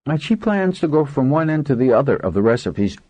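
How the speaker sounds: mumbling through a mouthful of pastry; mouth full. A narrator's reading. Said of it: "mumbled through her full mouth".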